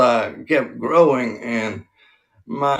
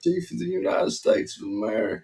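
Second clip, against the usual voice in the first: higher-pitched presidential impression; Bill Clinton; 6 semitones higher than usual. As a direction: proclaim it as a folksy ex-president. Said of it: "when you do his voice".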